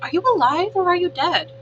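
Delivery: robot calls down from the tree, loud; robot calling down. A narrator's reading. Robot calling down to the creature in the shadows, loud call. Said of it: "The robot's voice called down from the tree".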